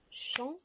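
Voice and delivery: soft syllable demo champ; isolated syllable. Shown as a demonstration. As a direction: model champ with nasal an. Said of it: "Première syllabe".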